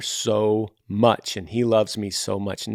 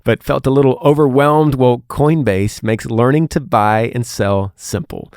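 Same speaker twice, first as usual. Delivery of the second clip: louder, punchy sponsor read; 5 dB louder than usual. Acted. Host pitching Coinbase to listeners, louder upbeat ad voice. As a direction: sell it like a podcast ad.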